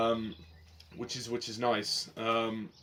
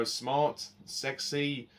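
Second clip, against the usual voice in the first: ordinary delivery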